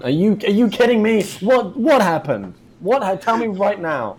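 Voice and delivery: high, loud, incredulous demand; exasperated outburst. Acted. Customer demanding the truth about his suit, exasperated. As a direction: blow up at an absurd excuse, pitch soaring.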